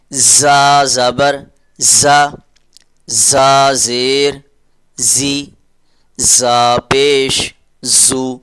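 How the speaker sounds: slow, drawn-out letter drill; slow teaching drill. Shown as a demonstration. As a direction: spell each sound out slowly for children. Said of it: "teacher drilling letter with vowel marks". (آہستہ تدریسی مشق)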